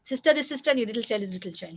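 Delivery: ordinary talk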